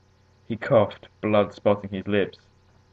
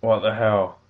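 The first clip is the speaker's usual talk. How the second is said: rasped outburst; 2 semitones higher, 4 dB louder than usual. A narrator's reading. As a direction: rasp it out in indignation. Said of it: "rasped a tall".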